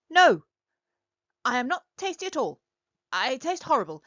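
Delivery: loud high No then fast gabble; a tiny creature scared of being eaten; panicked denial. Acted. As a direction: blurt it in a hurry.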